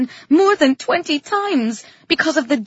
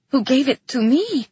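usual speaking voice

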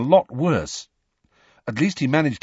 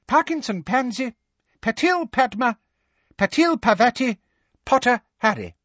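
very high, squeaky roll call; calling out names; 14 semitones higher than usual. A narrator's reading. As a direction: squeak out each name separately. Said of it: "Professor Flitwick called".

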